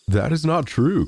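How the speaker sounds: a hint of a horned godfather crying out in fury; terrible voice. A narrator's reading. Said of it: "cried the pretended godfather, in such a terrible voice".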